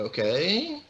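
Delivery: high, rising, uncertain okay; retold hesitant agreement, swooping up; doubtful okay. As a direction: agree as if totally lost. Unsure reply to improv orders.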